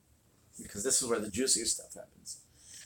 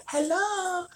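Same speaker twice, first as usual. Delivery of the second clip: put-on high, tiny little girl hello; 4 dB louder than usual. Granddaughter answering the house phone, little girl voice. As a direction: pipe it up like a small child answering. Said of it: "it's like this little girl".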